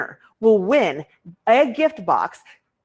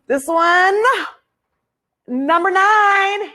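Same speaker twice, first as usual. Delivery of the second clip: high, loud, stretched-out announcement; excited winner reveal; 10 semitones higher, 5 dB louder, much slower than usual. Real, not acted. Host reveals the raffle winner, excited call. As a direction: call out the winning number with a big build.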